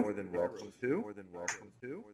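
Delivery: fading repeated echo; echo effect. Pretend. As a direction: let it echo away. Echoing the line.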